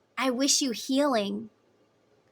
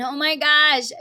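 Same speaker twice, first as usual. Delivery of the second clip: real loud, raised exclamation; surprised outburst; 6 semitones higher, 10 dB louder than usual. Real, not acted. Speaker seeing transformation come up again, loud surprise. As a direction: blurt it high and loud.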